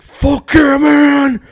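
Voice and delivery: screaming fired-up coach speech; Dan Campbell speech. Retold or imitated. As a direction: roar it like a locker room coach. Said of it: "out of breath just fucking reenacting what he says".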